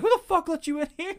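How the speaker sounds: mock the daughter snapping at him; a touch of an outraged teen girl; angry daughter. Daughter angrily quoted.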